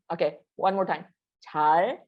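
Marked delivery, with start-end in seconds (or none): 1.4-2.0 잘 said with the tongue tip raised for the l. Shown as a demonstration. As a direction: lift the tongue for 잘. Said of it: "your tongue goes up".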